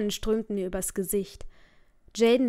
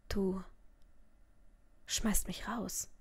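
stunned whisper; 10 dB quieter, slower than usual. Acted. Daughter stunned at being thrown out.

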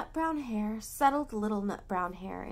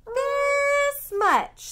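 much higher, loud little-bunny squeal; high excited little bunny; 12 dB louder than usual. Acted. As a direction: stretch it wide like a small bunny. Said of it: "said Little Nut Brown Hair".

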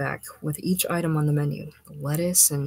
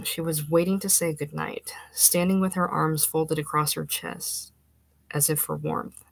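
plain reading voice

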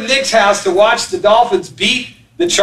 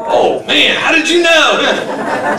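caught out; 5 semitones higher, slower than usual. Retold or imitated. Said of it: "audience voiced as caught liar".

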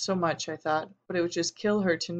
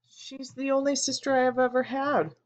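choked up, voice cracking; 7 semitones higher than usual. A narrator's reading. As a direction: voice breaks high with emotion. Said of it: "before my own voice cracked".

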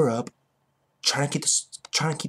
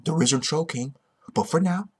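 normal talking voice

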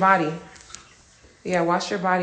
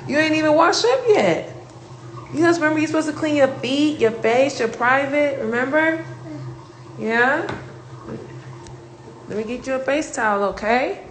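calling to daughter; 8 semitones higher, 6 dB louder than usual. Real, not acted. Mother calling to her daughter in the bath, loud and high.

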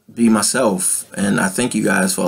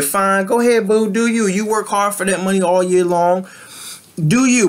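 sassy; 9 semitones higher than usual. Acted. Mock-sweet permission to ballers.

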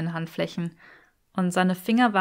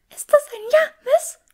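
hohe, gehauchte Elfenstimme, „Ja“ fragend angehoben; atemlos gekeucht; 7 dB louder than usual. A narrator's reading. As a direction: aufgeregt flüsternde Hauselfe, ganz außer Atem. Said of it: "keuchte Tuppy atemlos". (atemlos gehauchtes „Ist das ein Ja“ mit steigendem „Ja“)

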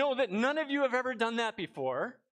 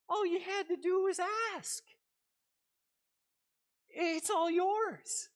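higher, gentle and slow at the end; tender father's heart; 9 semitones higher than usual. Acted. Father tenderly assuring his son it is all his. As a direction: offer it all freely. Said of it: "That's the father's heart".

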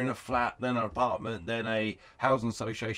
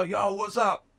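higher-pitched imitation of a hearty hello; upbeat phone greeting; 9 semitones higher, 4 dB louder, slower than usual. Celebrity answers a call on loudspeaker, upbeat greeting. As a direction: act out someone cheerily picking up.

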